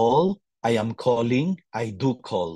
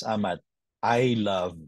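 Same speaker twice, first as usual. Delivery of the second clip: ordinary talk, nothing marked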